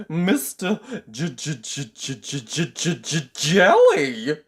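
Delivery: stuttering, rising to a loud squeak; frightened stammer. Acted. Mister Jelly telling the tramp his name, stammering. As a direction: stammer over every sound. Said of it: "stammered Mister Jelly".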